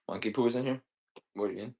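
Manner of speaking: normal talking voice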